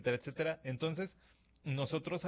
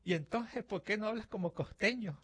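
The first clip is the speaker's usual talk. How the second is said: lightly voiced girlfriend, higher, puzzled; girlfriend's question; 9 semitones higher than usual. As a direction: play the girlfriend asking, a touch higher and surprised. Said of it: "me contestó de esta forma".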